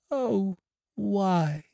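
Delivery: put-on high, pained girl's cry; a blind girl crying out in disbelief; cry of anguish. A narrator's reading. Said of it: "cried the blind girl".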